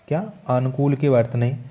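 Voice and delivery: ordinary talk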